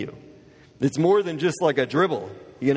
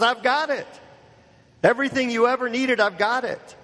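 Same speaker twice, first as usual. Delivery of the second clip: higher, ardent declaration; fervent promise; 8 semitones higher than usual. Acted. Preacher voicing Jesus offering everything, fervent and higher. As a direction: proclaim it as the answer to their thirst.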